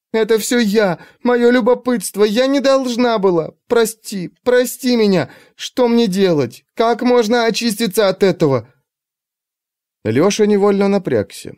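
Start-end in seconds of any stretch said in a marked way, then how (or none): 0.1-3.5 high, tearful confession; shaken tearful witness. A narrator's reading. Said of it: "сбивчиво рассказала". 3.7-4.3 tearful plea. A narrator's reading. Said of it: "сбивчиво рассказала". 4.4-8.7 higher, breaking plea on прости; tearful plea. A narrator's reading. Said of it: "сбивчиво рассказала".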